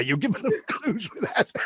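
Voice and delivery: real laugh breaking into teasing; laughing accusation. Real, not acted. Host breaks up teasing his guest.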